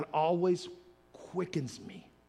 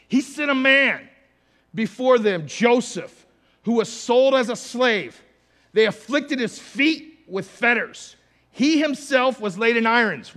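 loud, higher preaching of the psalm; preached scripture; 8 semitones higher, 14 dB louder than usual. Acted. Pastor reading Psalm 105 to the church, preached loud. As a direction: declaim the verse over the congregation.

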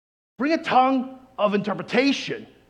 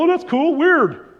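higher, louder mock reaction; offhand mock amazement; 8 semitones higher, 5 dB louder, slower than usual. Bystanders who heard tongues, mock amazed. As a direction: play a bystander casually wowed.

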